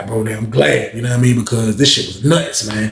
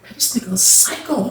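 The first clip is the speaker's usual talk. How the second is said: hushed disbelief; 11 semitones higher, 5 dB quieter than usual. Retold or imitated. Thinking during the fight.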